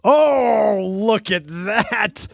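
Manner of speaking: high, drawn-out exclamation; long, high-pitched gasp of recognition; surprised discovery. Real, not acted. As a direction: stretch it out slowly in amazement. Reacts to another podcast's image.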